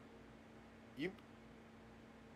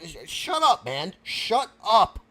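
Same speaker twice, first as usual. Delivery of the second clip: loud, raised "shut up"; angry silencing; 2 semitones higher, 6 dB louder, slower than usual. Acted. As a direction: cut him off with a loud order. Host silences the other man.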